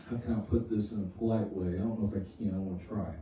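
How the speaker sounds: dropped low and soft, almost to himself; quiet aside. Real, not acted. Preacher hesitating over a crude image, softly. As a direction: ease the volume right down, thinking aloud.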